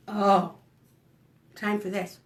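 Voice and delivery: usual speaking voice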